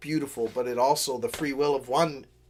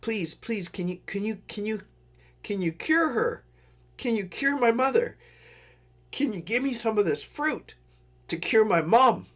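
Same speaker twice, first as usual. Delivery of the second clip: mock tearful plea, pitched higher; pleading; 7 semitones higher than usual. Diggory begging Aslan to cure his mother, mock tearful and higher. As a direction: beg like a crying boy, lightly acted. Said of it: "he starts crying and he says".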